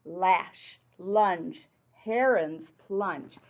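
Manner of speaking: punchy read-aloud rhyme. Acted. Reading rhyming picture book aloud.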